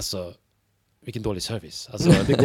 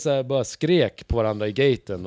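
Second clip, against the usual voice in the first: normal talking voice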